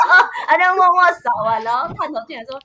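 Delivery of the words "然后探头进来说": normal talking voice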